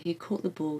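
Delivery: plain reading voice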